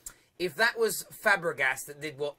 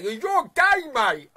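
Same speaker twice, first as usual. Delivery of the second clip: high, loud jeering imitation of a caller; mock caller taunt; 7 semitones higher, 8 dB louder, much slower than usual. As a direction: sneer it like a playground taunt. Mocking callers who insult him.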